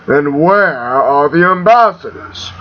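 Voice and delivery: slow, menacing villain demand; imperious, slowed interrogation; Darth Vader. Acted. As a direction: draw the question out like a dark lord. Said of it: "eh, Vader impression".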